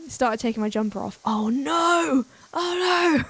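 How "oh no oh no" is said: high, hammed-up dismay; mock panic. Streamer recalls taking off the jumper in the office, mock panic. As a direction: act out a rising, drawn-out panic.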